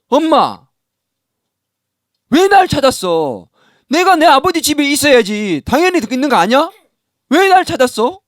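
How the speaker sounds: put-on a kid yelling back at mom; child shouting back. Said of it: "애도 호통치고".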